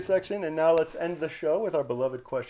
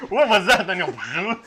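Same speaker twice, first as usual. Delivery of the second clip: laughing; 3 semitones higher, 6 dB louder than usual. Real, not acted. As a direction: laugh while asking. Laughing at co-host's guess.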